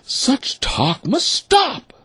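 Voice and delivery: very slow, high, stern mother duck; crying out mother duck. Acted. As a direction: lay down the law as mother duck. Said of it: "the mother cried".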